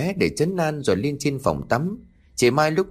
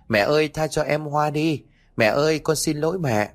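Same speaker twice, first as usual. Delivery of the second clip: put-on higher pleading boy through tears; crying child; 6 semitones higher than usual. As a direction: sob out the plea, higher. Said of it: "Nó vừa khóc".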